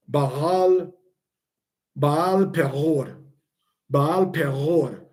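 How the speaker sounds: "Baal Peror" said slowly, with a deep guttural R-like sound in Peror; "Baal Peror" slow, the ayin in "Peror" growled deep in the throat like a French R. Shown as a demonstration. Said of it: "a very deep guttural G sound".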